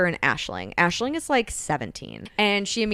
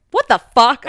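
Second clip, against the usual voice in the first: loud, higher-pitched acted indignation; outraged outburst; 6 semitones higher, 12 dB louder than usual. She catches him looking at naked girls, loud outrage. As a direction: burst out in mock outrage.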